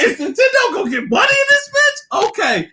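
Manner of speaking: high, strained half-crying laugh; laughing squeal. Retold or imitated. Telling friend about Nintendo money, laughing squeal. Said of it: "I was just like".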